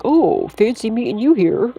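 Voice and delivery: sarcastic imitation of a stalker acting casual; mock sweet greeting. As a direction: put on a sugary fake surprise. Mocking a stalker's fake greeting.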